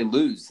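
"lose" as the short start of Louisiana; clipped first syllable of Louisiana. Shown as a demonstration. Host showing how some people begin the state's name, LOOZ. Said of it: "Some people say lose".

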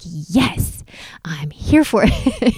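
drawn-out, voiceless mock-fervent whisper; emphatic whisper. Reacting to instructor's advice, fervent stage whisper. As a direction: whisper it slowly with hushed zeal.

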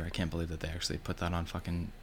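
ordinary talk, nothing marked

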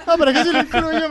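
high, laughing protest; laughing it off, pitch up. Real, not acted. Host laughing as she dodges the question.

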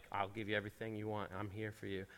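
soft, low tempter, lightly voiced; hushed voice of the ice cream; tempting ice cream voice. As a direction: coax him like a sly temptation.

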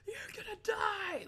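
high, loud drawn-out yell; drill sergeant. Retold or imitated. Drill sergeants warning recruits at basic training, yelled. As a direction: imitate a screaming drill sergeant. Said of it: "drill sergeants are".

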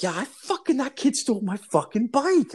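high-pitched retold grievance; indignant outburst. Son answering mom about the thief's name, indignant.

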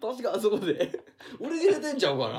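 laughing while playing the shop clerk; laughing through a quote. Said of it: "とか".

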